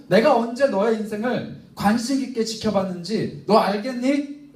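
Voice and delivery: God's imagined speech, high and loud, building; rising loud explanation. Acted. Preacher voicing God explaining to Moses, loud and rising. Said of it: "라고 이렇게 설명하지 않으신다고요".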